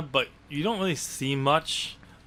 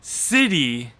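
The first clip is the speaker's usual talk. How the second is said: sarcastic air-quote stress on "city", louder; air-quoted; 6 dB louder than usual. Real, not acted. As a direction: lean on "city" as if putting it in quotes. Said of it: "I'm putting this in air quotes".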